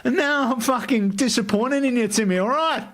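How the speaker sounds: high, hammed-up Australian accent, laughing through it; a bad Aussie mate scolding Timmy. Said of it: "trying this Australian accent".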